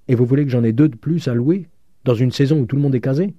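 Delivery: put-upon landlady protesting loudly; aggrieved complaint. A narrator's reading. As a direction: complain in rising disbelief. Landlady laments empty rooms.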